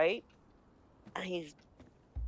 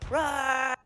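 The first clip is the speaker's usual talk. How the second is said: higher, louder mock war cry; battle roar; 7 semitones higher, 6 dB louder than usual. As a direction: charge in with a hammed-up battle yell. Armored guy charges in.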